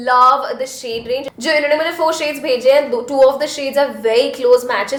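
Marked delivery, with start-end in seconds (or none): 1.4-3.0 Hindi-English code-switching; switching languages midsentence. Real, not acted. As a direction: swap between English and Hindi mid-line.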